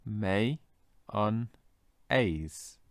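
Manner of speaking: MAY, UN, AISE as three separate beats; syllable by syllable. Shown as a demonstration. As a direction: break mayonnaise into "May, un, aise" with clear pauses. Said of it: "let's try one more time".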